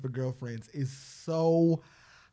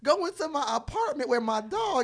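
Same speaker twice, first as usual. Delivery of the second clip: mock self-pity; 12 semitones higher, 8 dB louder than usual. Mock lament about hard life.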